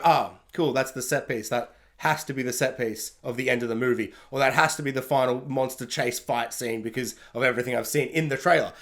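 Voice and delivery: low, quiet, unimpressed "oh, cool"; mock-deflated moviegoer; deflated viewer. Deflated viewer reacting to trailer.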